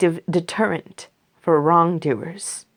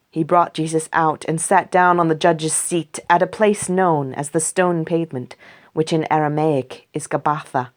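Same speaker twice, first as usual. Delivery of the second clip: ordinary delivery, nothing marked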